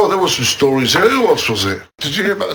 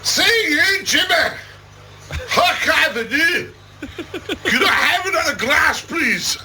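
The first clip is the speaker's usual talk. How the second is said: mock Scottish pub order; Scottish accent; 10 semitones higher, slower than usual. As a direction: put on a hammed-up Glaswegian barfly. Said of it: "How's that bit of Scottish?".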